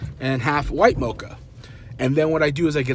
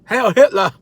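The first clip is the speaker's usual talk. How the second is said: loud, higher-pitched parody bark; hammed-up outraged repeat; mock shout; 6 semitones higher, 8 dB louder than usual. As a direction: shout it as an absurd exaggeration.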